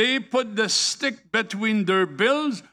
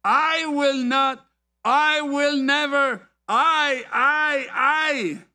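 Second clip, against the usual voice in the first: "I" hit high and drawn out each time; stress on I; 5 semitones higher, slower than usual. Retold or imitated. Preacher mimicking Peter's I problem, stressed "I". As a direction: lean proudly on every "I", stretching it. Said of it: "The letter I".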